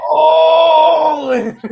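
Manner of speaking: high, loud triumphant shout; excited yell. Real, not acted. Friend answers the cheer with a high excited shout. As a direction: scream it back with glee.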